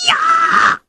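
very high, sharp cry of alarm; a sudden startled "ya!" of shock; shocked yelp. Acted. Storyteller voices shocked cry. (一声极高的惊叫)

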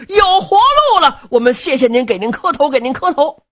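high, loud, jubilant crowd of prisoners; 激动拜谢. Acted. 众犯人激动拜谢首领. (激动地高声拜谢)

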